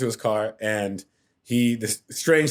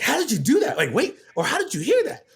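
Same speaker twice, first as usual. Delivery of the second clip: high-pitched incredulous questioning; amazed disbelief; 13 semitones higher than usual. Retold or imitated. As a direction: retell being utterly baffled, voice way up. Retelling amazed reaction to [name].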